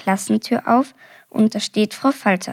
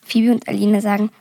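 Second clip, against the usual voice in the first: usual speaking voice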